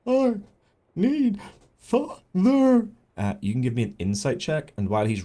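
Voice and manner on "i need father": high, loud voice, word by word; halting creature wail with pauses between words. Acted. Creature answers what it needs, high halting wail.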